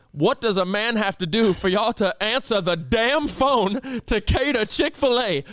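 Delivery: slow, emphatic indignant rant; imitating a fed-up grandpa scolding staff; mock-irate, drawing out the complaint; angry old man. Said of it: "he's just angry".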